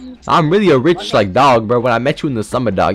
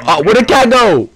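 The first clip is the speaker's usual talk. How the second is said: surprised; 7 semitones higher than usual. Real, not acted. Cat vanishes in game.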